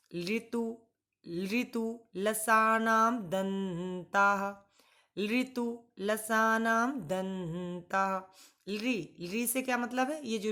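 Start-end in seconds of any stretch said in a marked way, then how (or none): 0.1-4.4 slow, syllable by syllable sutra recitation. Shown as a demonstration. Teacher reciting the sutra slowly to students. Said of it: "अब इसका सूत्र यदि हम देखना चाहें". 5.2-8.2 slow, even repeat of the sutra; slow recitation. Shown as a demonstration. Teacher repeating the sutra slowly for students. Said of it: "अब इसका सूत्र यदि हम देखना चाहें".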